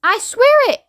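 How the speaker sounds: high-pitched, desperate insistence; pleading cry. A narrator's reading. Shuckworth pleading with ground control, high cry. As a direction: cry out to be believed. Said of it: "cried poor Shuckworth".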